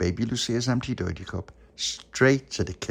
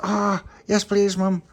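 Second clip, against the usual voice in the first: put-on child voice; 12 semitones higher, 6 dB louder, slower than usual. Child voice asking mum.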